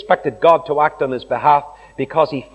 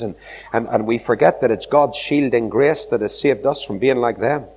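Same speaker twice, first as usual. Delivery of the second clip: ordinary talk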